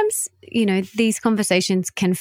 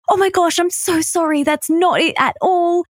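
higher, gushing mock apology; apologetic friend; 10 semitones higher than usual. A friend apologising for lateness, in a higher gushing voice. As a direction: gush out a sorry like a caught-out friend. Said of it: "she will most likely go".

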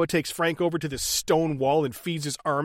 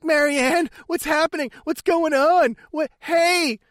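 mock calling out; 7 semitones higher than usual. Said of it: "He's like".

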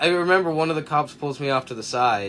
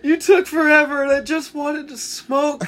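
put-on whiny drunk voice; 13 semitones higher, 4 dB louder than usual. Drunk crying friend voiced.

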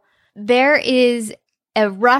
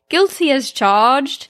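slow, higher-pitched mock British accent; 4 semitones higher, slower than usual. Ian says the same line to Bob, British accent. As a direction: quote it in put-on British. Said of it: "He says".

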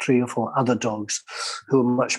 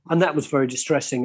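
normal talking voice

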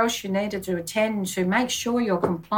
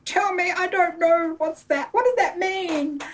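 higher, bewildered questions; baffled panic; 8 semitones higher than usual. Retold or imitated. To her IT people, asking what phishing means, in baffled panic. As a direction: plead to be told what it means.

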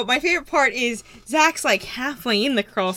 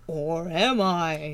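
spooky; 7 semitones lower, much slower than usual. Pretend. Spooky joke.

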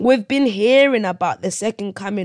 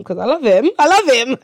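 higher, louder mock-emotional plea; emotional protest; 6 semitones higher, 8 dB louder than usual. Younger self defends a boyfriend to family guest, mock-emotional plea. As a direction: plead it with hammed-up emotion, climbing higher and louder. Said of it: "getting a little bit emotional".

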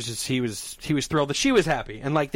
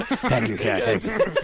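goblin thanks; 5 semitones lower, slower than usual. Pretend. Goblin thanks him for gizzards.

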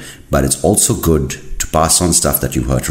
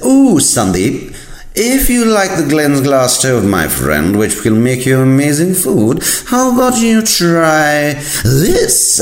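high, drawn-out mock sales pitch; hammed-up online shop upsell; gushing salesman; 14 semitones higher, slower than usual. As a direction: slowly tempt the customer in an excited high voice.

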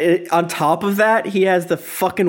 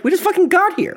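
high-pitched mock outrage; acted incredulous complaint; exasperated protest; 13 semitones higher than usual. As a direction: protest as the fed-up friends, voice shooting up. Acted protest of dragged-along friends.

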